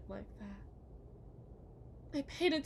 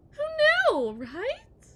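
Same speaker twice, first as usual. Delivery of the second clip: much higher and louder, sarcastic squeal; mocking shriek; 14 semitones higher, 14 dB louder, much slower than usual. Wife mocking husband.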